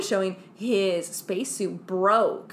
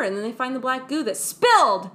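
ordinary talk

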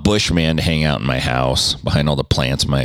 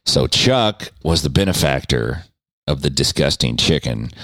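ordinary delivery

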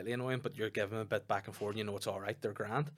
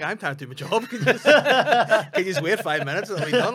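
high, much louder, laughing through the words; laughing plea; 9 semitones higher, 13 dB louder than usual. Retold or imitated. Retelling asking a heckler to wait, laughing loudly. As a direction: crack up while pleading to the heckler.

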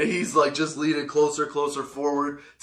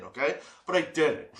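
usual speaking voice

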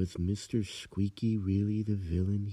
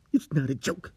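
snapped; 3 semitones higher, 5 dB louder than usual. Acted. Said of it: "he snapped at me".

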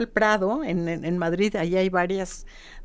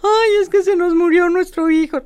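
grieving parent; 9 semitones higher, 7 dB louder than usual. Retold or imitated.

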